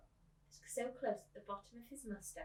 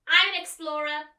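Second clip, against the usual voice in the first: put-on proud child; 7 semitones higher, 17 dB louder than usual. Coraline voiced proudly.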